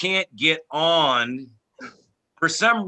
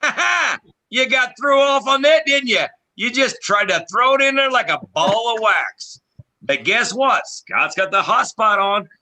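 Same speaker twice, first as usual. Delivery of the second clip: loud, higher-pitched triumphant crowing; playful gloating; 5 semitones higher, 4 dB louder than usual. Real, not acted. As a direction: crow over outwitting your friends. Playful gloating over the others.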